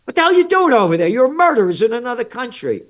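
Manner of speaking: fast, high, loud furious outburst; angry shout. Real, not acted. Berating the Dutch in Indonesia, a fast angry shout. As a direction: yell it like you're accusing them to their face.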